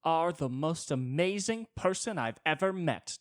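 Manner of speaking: high, loud over-the-top gushing; mock gushing to a stranger; gushing praise. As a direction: lay the flattery on thick. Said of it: "the one that's like".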